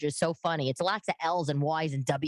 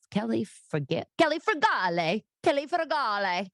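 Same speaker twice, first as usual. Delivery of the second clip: Kelly Frugale sung out Italian style, higher; mock Italian accent; 6 semitones higher than usual. Italians repeating roommate's name in mock Italian accent. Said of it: "they were like".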